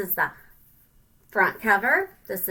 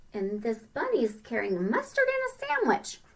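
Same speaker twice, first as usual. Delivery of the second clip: usual speaking voice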